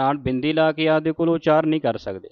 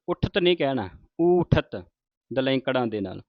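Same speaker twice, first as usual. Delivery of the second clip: ordinary talk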